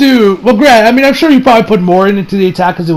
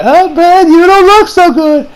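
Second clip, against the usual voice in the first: high, loud mock concern; hammed-up concern, high-pitched; worried exclamation; 11 semitones higher, 5 dB louder, slower than usual. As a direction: act out a worried friend, pitched way up. Acted concern for hurt Master Roshi.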